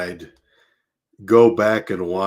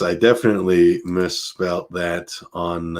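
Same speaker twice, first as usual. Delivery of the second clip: ordinary talk, nothing marked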